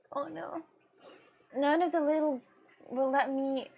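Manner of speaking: ordinary talk, nothing marked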